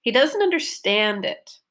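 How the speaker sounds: last syllable of "understand" lifted, then falling; stress on UNDERSTAND. Shown as a demonstration. Teacher models her focus word, stress on "understand". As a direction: punch the "stand" in "understand". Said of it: "third syllable that I stressed".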